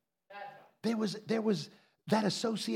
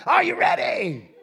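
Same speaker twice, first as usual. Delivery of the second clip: high, loud, dragged ready call; imitating a preacher's shout; Alan's preacher call; 10 semitones higher, 6 dB louder, slower than usual. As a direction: fire up the crowd like Alan. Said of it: "That's how Alan did".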